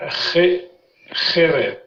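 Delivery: "Ché-" alone, then "Kherev", both with throaty kh; chet sound. Shown as a demonstration. Teacher drilling a student, throaty kh in "Ché-, Kherev". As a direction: break out the first syllable of "Kherev" with kh. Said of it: "c'est un chet".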